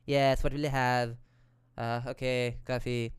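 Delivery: lightly mocked Valley girl accent; bored LA girl accent. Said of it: "She speaks in that typical annoying L A girl accent".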